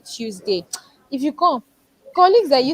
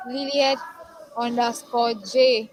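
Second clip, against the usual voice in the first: dictated slowly, word by word; slow spelled-out handle; slower than usual. Real, not acted. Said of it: "my I G is".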